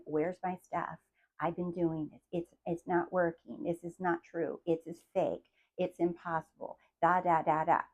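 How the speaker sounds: soft, grumbling mimicry of a doubter; mock whiny complaint. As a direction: mumble it like a sulking skeptic.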